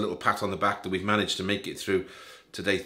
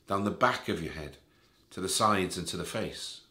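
softer, lower meditation voice with long pauses; guided meditation; 3 semitones lower, 4 dB quieter than usual. Real, not acted. Guide leading listeners through a body scan, calm guided meditation. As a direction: ease the listener gently through each part of the body, settling softer as it goes.

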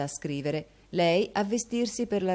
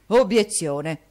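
much louder outraged cry; Obiezione! shot out in disbelief; indignant exclamation; 2 semitones higher, 9 dB louder, slower than usual. Acted. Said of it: "esclamò la signora Cramols".

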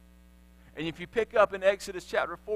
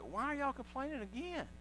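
higher, softer, weary comeback; exasperated Moses; 6 semitones higher, 12 dB quieter than usual. Acted. Moses answering the grumbling people, exasperated. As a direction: sigh it out like a tired leader. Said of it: "Moses is like".

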